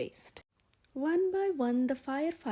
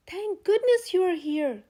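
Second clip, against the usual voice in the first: higher, louder relieved exclamation; relieved cry; 5 semitones higher, 6 dB louder than usual. A narrator's reading. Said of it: "cried the cook".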